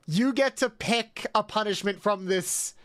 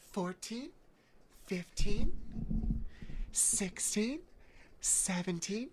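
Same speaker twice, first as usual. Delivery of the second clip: soft mommy voice; 12 dB quieter, slower than usual. Pretend. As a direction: keep the sultry mommy voice while counting squats. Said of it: "mommy voice".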